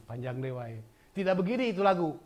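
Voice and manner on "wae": ordinary talk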